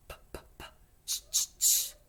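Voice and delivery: whispered p's, then loud high ch bursts; consonant drill. Shown as a demonstration. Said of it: "I'll go first. You be my echo".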